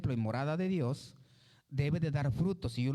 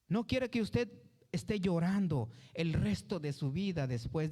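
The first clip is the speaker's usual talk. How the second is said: ordinary talk, nothing marked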